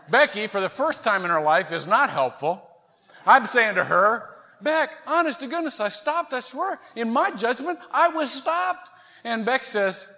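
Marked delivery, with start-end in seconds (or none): 4.6-8.9 high-pitched protesting plea; replayed earnest, whiny self-justification; pleading innocence. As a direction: plead with your wife to believe you. Pleading his case to wife.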